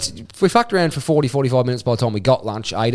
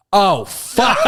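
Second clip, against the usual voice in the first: laughing; 4 semitones higher than usual. Retold or imitated. Seeing the drop on slide.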